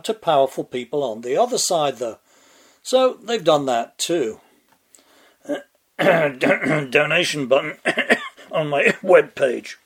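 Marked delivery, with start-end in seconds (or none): 5.5-9.9 words smuggled out between staged coughs; hammed-up throat-clearing around each word; fake coughing fit. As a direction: cough through a sly plug, pretending your throat is blocked. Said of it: "got something stuck in my throat there".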